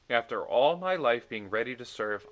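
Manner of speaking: ordinary delivery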